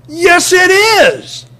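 high, loud insistent rebuttal; emphatic rebuttal. Acted. Preacher rebutting the indifferent believer, high and loud insistent. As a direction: fire back at the objection, high and loud.

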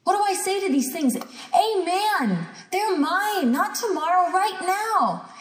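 high, ringing declaration; emphatic, high-pitched claim of victory; declaring attitude of faith. Shown as a demonstration. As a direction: proclaim it as yours with total conviction. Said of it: "that's gotta be your attitude".

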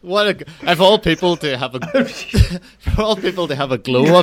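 laughing, high and loud. Real, not acted. Host cracking up over frostbit guy's glow up, laughing. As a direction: struggle to get it out through laughter.

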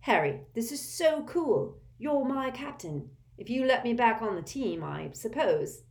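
delighted teasing; gleeful. A narrator's reading. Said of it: "examining the badge with glee".

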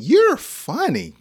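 high, drawn-out, laughing delight; amused praise. Acted. Teacher tells a surprisingly funny friend, laughing praise. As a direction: break into laughter as you praise.

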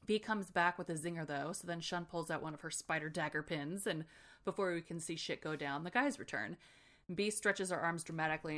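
ordinary talk

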